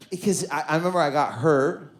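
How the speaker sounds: ordinary talk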